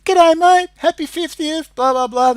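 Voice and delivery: high, loud hearty phone greeting; Peter Shack. Retold or imitated. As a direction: imitate a chummy caller singing out hello. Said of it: "Peter Shack's ringing".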